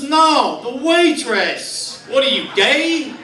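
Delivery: high, drawn-out indignant retort; mock-yell it like an exasperated sports fan; yelling fan, imitated. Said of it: "then he yells at the screen".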